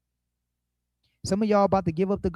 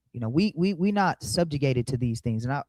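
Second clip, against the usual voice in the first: ordinary delivery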